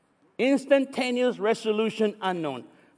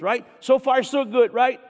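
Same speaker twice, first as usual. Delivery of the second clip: normal talking voice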